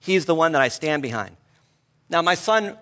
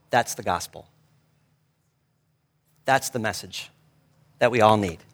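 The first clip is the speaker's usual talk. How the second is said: hushed awe; 6 semitones lower than usual. Retold or imitated. Father moved by his son's words, hushed and reverent. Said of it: "And I was like".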